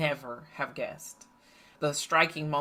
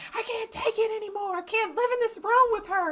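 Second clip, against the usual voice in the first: put-on much higher, whiny fed-up teenager; mother imitating her oldest daughter's complaint, much higher; exasperated teen daughter. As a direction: play the daughter at the end of her rope. Teen daughter storms downstairs complaining.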